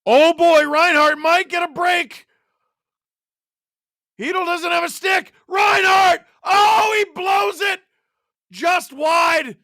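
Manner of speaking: high, loud shouted call of a chance; excited play-by-play. Real, not acted. Calls a live breakaway chance.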